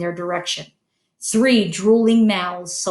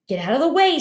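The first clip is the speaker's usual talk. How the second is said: snapping; 6 semitones higher, faster than usual. Acted. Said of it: "snapped Ron".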